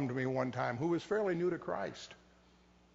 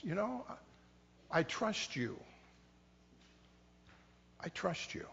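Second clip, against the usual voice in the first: soft, intimate words of trust to God; quiet trusting prayer; 9 dB quieter than usual. Retold or imitated. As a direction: whisper your trust like a prayer.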